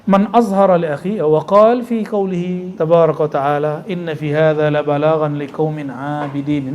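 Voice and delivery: intoned Arabic verse, set apart from the talk; Quran recitation. Acted. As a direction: recite the verse in a measured, devout way.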